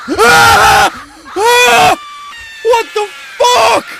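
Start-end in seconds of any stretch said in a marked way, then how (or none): 0.1-2.0 sharp startled screams; scared scream. Real, not acted. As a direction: yelp in fright. Startled by the game.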